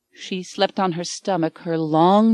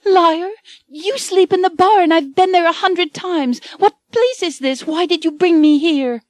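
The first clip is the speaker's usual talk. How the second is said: much higher, louder, frightened woman crying out; terrified accusation; 11 semitones higher, 5 dB louder than usual. A narrator's reading. Anne terrified in a strange bedroom, accusing Michael in a high panicked cry. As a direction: hurl it at him in panic.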